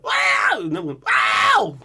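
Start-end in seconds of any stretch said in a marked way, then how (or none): 0.0-0.6 very high, loud metal shriek. Retold or imitated. Imitating the singer for viewers, screamed very high. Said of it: "[name] just screams like". 1.0-1.8 high, loud falling metal shriek. Retold or imitated. Imitating the singer for viewers, screamed high. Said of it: "[name] just screams like".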